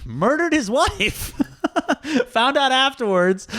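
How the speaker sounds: high, slow laughing disbelief. Real, not acted. Telling a friend about his boss, laughing. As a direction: laugh out the shocking news. Laughing through shocking reveal.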